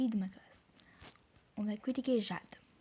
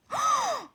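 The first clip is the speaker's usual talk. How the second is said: sharp high-pitched gasp; excited gasp; 5 dB louder than usual. Real, not acted. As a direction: gasp loudly at a lucky find. Reacts to lucky game find. (hoquet de surprise aigu)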